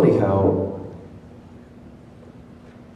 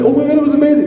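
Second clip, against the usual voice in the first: amazed; 4 dB louder, much faster than usual. Retold or imitated. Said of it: "You're like".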